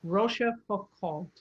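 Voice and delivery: "Rochefoucauld" slow, roh-sheh-foo-kohl, every syllable said. Shown as a demonstration. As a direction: spell "Rochefoucauld" out slowly by syllable. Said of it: "So you say every syllable said".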